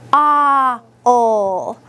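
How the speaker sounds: "Ah, ol" as separate, held sounds; sounding out. Shown as a demonstration. Teacher sounding out a syllable for the class, sound by sound. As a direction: stretch "Ah", then "ol", one sound at a time. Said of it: "let's sound out the first syllable".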